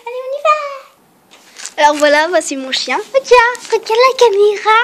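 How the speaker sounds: high, loud coaxing voice to a dog. Real, not acted. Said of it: "je suis un peu à gaga avec".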